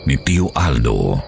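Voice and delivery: ordinary talk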